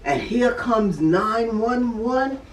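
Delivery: usual speaking voice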